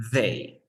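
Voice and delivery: tongue between teeth to begin "they"; voiced TH demo. Shown as a demonstration. Teacher sums up that it is no D, voiced TH demo. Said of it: "No es una D natural".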